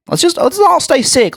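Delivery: high-pitched sarcastic mock suggestion. Sarcastic retort in retold quarrel.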